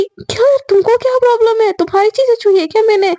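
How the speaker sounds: acted touchy comeback; indignant challenge. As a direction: push back, put out at being scolded.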